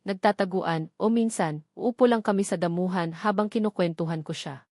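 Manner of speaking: usual speaking voice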